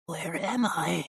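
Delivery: high, very slow rattling puppet voice; soft harsh doll voice. A narrator's reading. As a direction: rattle it out softly, drawn out and high. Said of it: "a soft, harsh voice rattled".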